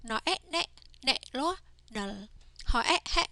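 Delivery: teacher's sing-song spell-and-read drill. Shown as a demonstration. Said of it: "let's practice reading these words".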